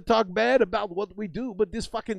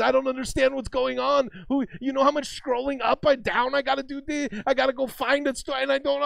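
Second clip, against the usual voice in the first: higher, fretful kid voice, mildly hammed; panicked phone kid; 6 semitones higher, 4 dB louder than usual. Streamer imitating kids missing phone time, higher fretful voice. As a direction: lightly play a frantic kid who missed his feed.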